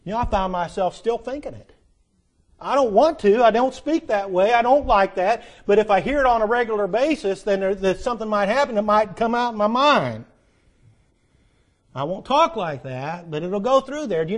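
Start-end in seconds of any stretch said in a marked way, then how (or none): 2.6-10.2 louder, higher, pressing the point home; preaching at full force. Real, not acted. Preacher urging the congregation about bad influences, louder and higher. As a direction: preach it with rising conviction.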